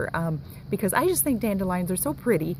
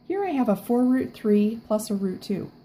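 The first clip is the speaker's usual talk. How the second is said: normal talking voice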